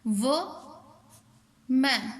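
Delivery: "वो", long pause, "मैन"; split into syllables. Shown as a demonstration. Teacher counting two syllables, "वो. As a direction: say "वो मैन" as two isolated beats. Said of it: "टू syllable".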